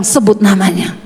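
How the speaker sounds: lightly voiced God, low pitch; low retold command, as if from God; God's voice, retold. God telling the speaker to name the person, lightly voiced low command. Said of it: "Tuhan bilang".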